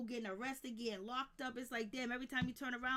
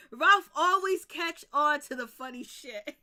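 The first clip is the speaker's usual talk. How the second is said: laughing; 3 semitones higher, 11 dB louder, slower than usual. Real, not acted. Still laughing at chat joke.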